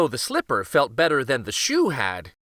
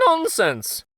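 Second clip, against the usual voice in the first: higher, firm dismissal; brisk queen; 10 semitones higher than usual. Acted. Queen to the king, high firm scoff. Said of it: "the queen asked".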